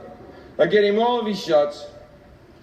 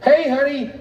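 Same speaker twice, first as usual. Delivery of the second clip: imitated wife, high and sweet; mock-sweet wifely hello, raised voice; sweet greeting; 8 semitones higher, 4 dB louder than usual. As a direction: pitch it up like a loving partner's hello. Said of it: "lovingly playing with our child".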